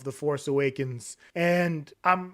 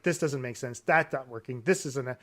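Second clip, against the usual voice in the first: muttered disappointment; 5 semitones lower, 6 dB quieter than usual. Retold or imitated. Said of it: "I was like".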